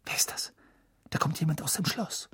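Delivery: hushed alarmed whisper; whisper, afraid to be seen. Acted. Spotting a man at night. (flüsternd)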